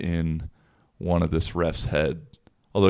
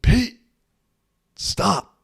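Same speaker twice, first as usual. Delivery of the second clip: high, firm mock warning; stern ref; 14 semitones higher than usual. Ref warning the coach to stop, high firm mock voice. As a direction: cut him off like a fed-up ref. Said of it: "And the ref said".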